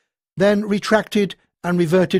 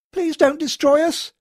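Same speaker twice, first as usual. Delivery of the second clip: high, desperate wail; wailing plea; 11 semitones higher, 3 dB louder than usual. A narrator's reading. Marie pleading with the machines, high wailing. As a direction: beg for your life. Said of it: "Marie wailed".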